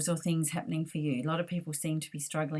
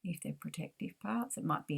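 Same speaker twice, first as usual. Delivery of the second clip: guided meditation; 3 dB quieter than usual. Acted.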